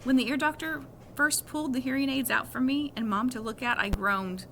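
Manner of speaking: plain reading voice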